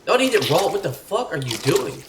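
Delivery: high, loud disbelieving outburst; shocked shouted reprimand; alarmed scolding. Real, not acted. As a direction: yell to stop him, appalled. Scolding companion eating raw meat.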